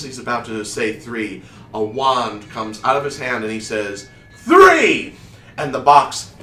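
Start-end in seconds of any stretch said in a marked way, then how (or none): 4.3-5.1 high, loud, falling cry; climactic magician's shout. Acted. Wizard waving his wand to finish the trick, shouting. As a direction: belt out the final count.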